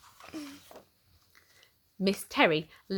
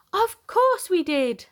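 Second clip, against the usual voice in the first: much higher, louder and slow, beaming; eager chorus; 11 semitones higher, 6 dB louder, slower than usual. Acted. Twins eagerly telling Mummy they loved school. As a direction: sing it out like two delighted kids.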